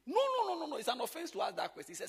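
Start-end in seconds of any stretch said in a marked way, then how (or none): 0.1-0.8 loud, much higher protest; emphatic no. Real, not acted. Pastor loudly refusing the idea of skipping meetings. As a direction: cry out no, shocked. Rejecting a question.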